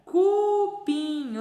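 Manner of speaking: "Cupim" high with a long "cu"; syllables sounded out. Shown as a demonstration. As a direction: hold "cu" before "pim". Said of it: "o C e o U".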